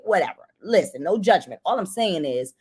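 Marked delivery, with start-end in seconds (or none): none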